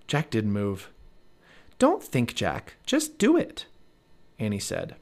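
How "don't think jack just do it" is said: put-on high, pushy young girl; little girl voice. A narrator's reading. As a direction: urge him on in a high girl's voice. Narrator voices Annie.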